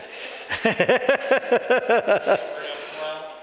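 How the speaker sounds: a long run of amused giggles; real laughter. Real, not acted. Teacher amused by the American's accent, long real laugh.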